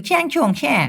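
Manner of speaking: high mock gibberish in pitch; joking fake Chinese babble. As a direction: pretend to be Chinese with nonsense sounds. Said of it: "মানে China যদি আমি সাজি".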